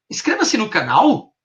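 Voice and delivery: slow "Inscreva-se no canal" lifted high like a question; questioning intonation. Shown as a demonstration. Hypnotherapist demonstrates three tones, questioning. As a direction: end on a rising, questioning CANAL. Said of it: "A minha terceira entonação".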